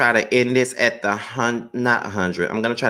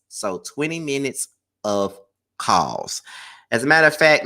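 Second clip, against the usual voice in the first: normal talking voice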